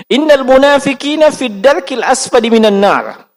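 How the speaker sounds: recites the verse in a chanting Quran style; Quran recitation. Shown as a demonstration. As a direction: intone the Arabic verse like a recitation. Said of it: "masih surah An-Nisa". (melantunkan ayat seperti tilawah)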